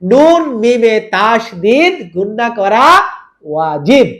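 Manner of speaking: slow, drawn-out chant of the rule; slow recital rule. Shown as a demonstration. Teacher recites the rule slowly for students to repeat. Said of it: "বলেন".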